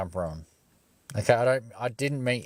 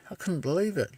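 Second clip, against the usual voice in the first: disbelief; 9 semitones higher than usual. Real, not acted. Disbelief at cop.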